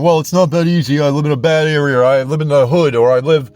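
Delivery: high, loud mocking voice of a complainer; mock the listener's excuses, nasal-free but whiny and loud; whiny excuse-maker. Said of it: "You're saying".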